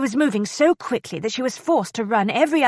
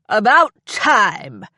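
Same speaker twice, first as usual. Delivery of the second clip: slow, louder irritated snap; enraged; 6 dB louder, slower than usual. A narrator's reading. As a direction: spit it out with impatience. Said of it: "the enraged man began".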